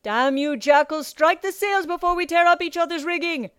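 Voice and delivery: high, loud angry call; cursing captain bellowing across the water; Captain Nightlighter. A narrator's reading. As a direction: shout a warning at the enemy ship. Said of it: "Captain Nightlighter called".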